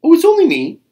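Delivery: very high, loud, innocent chirp. A narrator's reading. Bird answers the startled Tiger, innocently. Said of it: "answered Bird innocently".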